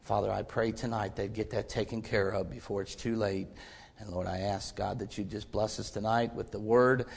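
low, soft, hushed prayer. Acted. Preacher praying for the unsaved, hushed and soft. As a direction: pray quietly and humbly to the Father.

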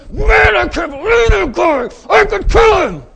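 high, loud furious outburst from Naaman; enraged shout. Retold or imitated. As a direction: shout it like a general in a rage. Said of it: "He went away flailing".

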